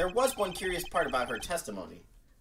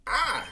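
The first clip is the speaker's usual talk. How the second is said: startled cry; 9 semitones higher, 4 dB louder than usual. Acted. Shocked realization cry.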